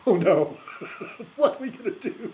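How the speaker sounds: high mock dismay breaking into laughter; mock panic. Republican establishment fretting over Ron Paul, laughing mock panic. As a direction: play at panicking, laughing through it.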